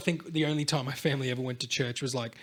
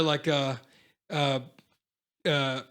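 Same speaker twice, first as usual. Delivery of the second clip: ordinary talk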